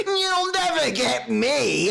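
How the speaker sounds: high, drawn-out mock villain taunt; taunting orc. Orc popping up to taunt the player, high and slow. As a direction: stretch it slowly in a high creature voice.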